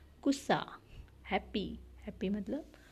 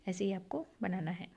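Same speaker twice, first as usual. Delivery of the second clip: ordinary delivery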